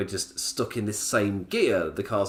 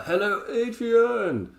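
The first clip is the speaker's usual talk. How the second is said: hello, Adrian, pitched way up; high, bright greeting; 10 semitones higher, much slower than usual. Real, not acted. Greeting a viewer named Adrian, high and cheery.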